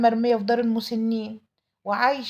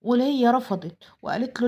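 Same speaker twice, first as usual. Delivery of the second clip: ordinary delivery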